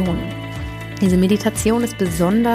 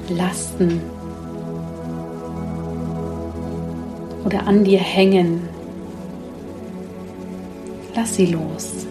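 sleep meditation. Acted. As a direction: guide them gently into sleep.

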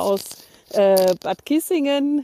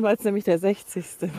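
usual speaking voice

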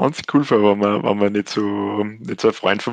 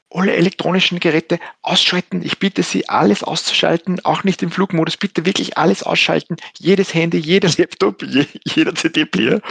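higher, urgent flight attendant voice; nervous; 7 semitones higher than usual. Retold or imitated. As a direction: plead with the passengers, nervously rushing. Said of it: "in einem richtig nervösen Ton gesagt".

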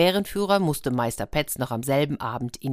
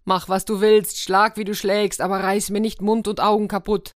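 high, louder whining devil's plea; wailing devil; 6 semitones higher, 3 dB louder than usual. A narrator's reading. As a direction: beg for mercy in a put-on devil wail. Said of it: "jammerte der Teufel". (hohes, jammerndes Flehen des Teufels)

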